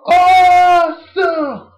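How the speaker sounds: drawn-out, high, loud catchphrase; Miz catchphrase. Retold or imitated. Fan mimicking The Miz's entrance catchphrase, drawn out. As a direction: stretch it like a ring entrance. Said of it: "The Miz's catchphrase".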